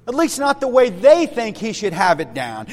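usual speaking voice

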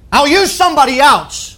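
imagined angry dismissal. Retold or imitated. Imagined God giving up on Moses, high and emphatic. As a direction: imitate a fed-up master dismissing his servant.

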